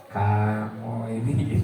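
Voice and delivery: slow, low pointed scolding; mock stern reproach. Shown as a demonstration. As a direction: drag it out like a stern teacher wagging a finger. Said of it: "jari menunjuk-nunjuk gini".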